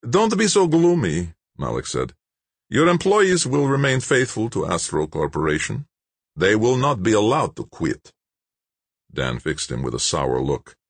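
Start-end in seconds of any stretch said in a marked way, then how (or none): 0.1-8.1 higher, louder, self-satisfied bureaucrat; smug official Malik. A narrator's reading. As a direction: announce the shutdown coolly, pleased with yourself. Malik's voice held.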